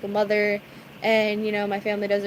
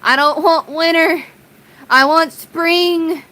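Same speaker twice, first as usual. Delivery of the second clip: louder whine, slowing down; louder drawn-out whine; whiny protest; 5 semitones higher, 7 dB louder, slower than usual. Retold or imitated. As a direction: complain like a kid. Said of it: "and I'm just like".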